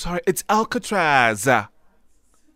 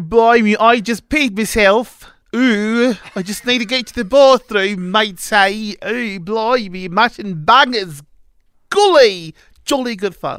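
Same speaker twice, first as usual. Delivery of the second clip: loud, exaggerated English accent, higher pitched; 4 semitones higher, 5 dB louder than usual. Shown as a demonstration. Asked by the radio host for his English accent, hammed-up English accent. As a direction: ham up an English accent, drawing out the slang. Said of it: "give me your English accent".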